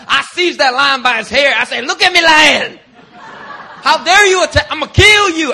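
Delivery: hammed-up heroic shout at the lion; fierce David. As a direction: roar it like a fearless shepherd boy. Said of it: "It's like".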